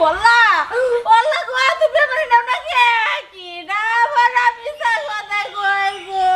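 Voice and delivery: long, drawn-out sobbing lament; theatrical wailing. Acted. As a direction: wail and drag out every word. Theatrical wailing over arm. (টেনে টেনে নাটুকে বিলাপ)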